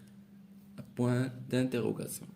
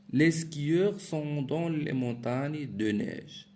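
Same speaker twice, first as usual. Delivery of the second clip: slow, higher and louder, read out to the class; reading aloud; 4 semitones higher, 3 dB louder, slower than usual. Shown as a demonstration. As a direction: read the example sentence out clearly and slowly. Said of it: "teacher reads the exercise sentence". (lecture lente et appuyée à voix haute)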